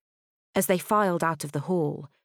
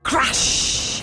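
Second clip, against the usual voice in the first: explosive, hissing sound effect; crash sound word; 8 semitones higher than usual. A narrator's reading. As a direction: burst out the word like an impact. Narrator voices the impact.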